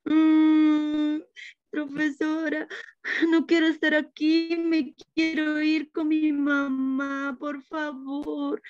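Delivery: put-on crying little boy. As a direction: beg like a tearful toddler on his first school day. Matías crying, begging to leave.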